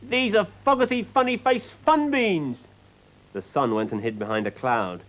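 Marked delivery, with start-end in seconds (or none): 0.1-2.7 put-on high, slow indignant child; outraged little girl. A narrator's reading. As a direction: protest like a spoilt girl betrayed. Child outraged at wrong beans.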